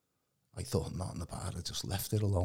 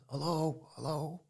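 calling out; 7 semitones higher, slower than usual. Retold or imitated. Stranger calling out in ruin.

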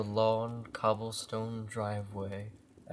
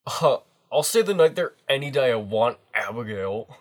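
put-on cocky teen boy; 3 semitones higher, 10 dB louder, faster than usual. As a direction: brag like a cocky teenager. Voicing teen Jason.

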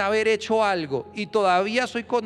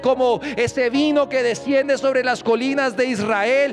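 rising, louder declaration, climbing higher at the end; preaching at full force; 4 semitones higher, 3 dB louder than usual. Acted. Pastor leads the church in bold faith declarations. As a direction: proclaim it over the church. Declarations building over music.

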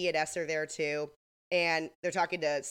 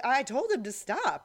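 mock stunned, higher pitch; in shock; 7 semitones higher, 4 dB louder than usual. [name] pulled away from the blast, imitated shock. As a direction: imitate dazed disbelief. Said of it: "like, in shock".

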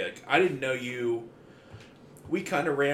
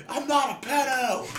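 mock yell. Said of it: "And he's yelling at the".

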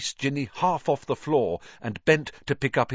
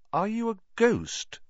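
uncertain; 12 semitones higher than usual. Acted. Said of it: "Harry said uncertainly".

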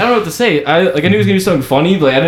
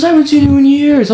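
much higher, mock-delighted greeting; fake reunion delight; 11 semitones higher, 3 dB louder than usual. Friend faking a chance reunion in line, hammed-up delight. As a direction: play up a staged reunion, pitched high. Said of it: "fake walk up".

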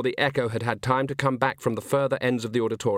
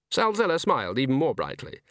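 ordinary delivery

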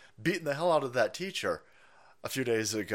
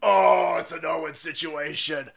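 despairing recruiter; much slower than usual. Retold or imitated. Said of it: "He's like".

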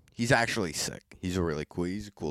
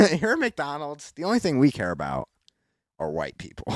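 ad announcer; 4 semitones higher, 7 dB louder than usual. Acted. Mock McDonald's ad.

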